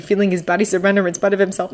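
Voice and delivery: ordinary delivery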